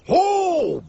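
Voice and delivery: exaggerated oh. Mentor's exaggerated reaction.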